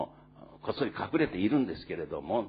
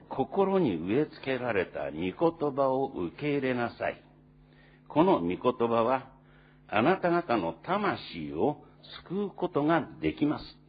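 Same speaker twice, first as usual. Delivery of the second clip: slow, raised, solemn Bible reading; scripture reading; 6 semitones higher, 6 dB louder, slower than usual. Acted. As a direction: read the verse out slowly and fervently to the congregation. Reads scripture passage aloud. (ゆっくり力強く聖書を朗読する)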